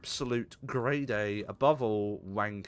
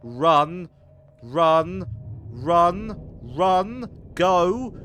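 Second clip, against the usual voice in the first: frantic yelling run; panicked shouting; 5 semitones higher, 9 dB louder, much slower than usual. Real, not acted. Fleeing a monster in game.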